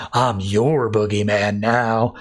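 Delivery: lightly voiced menace, low and slow; menacing Batman. Batman turning on Joe Chill in jail, lightly menacing.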